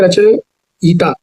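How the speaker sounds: रचयिता slowly, with यि-ता held apart; broken into syllables. Shown as a demonstration. Teacher showing the correct spelling, रचयिता slowly in parts. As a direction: draw out the ending of रचयिता. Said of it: "यह रहता है".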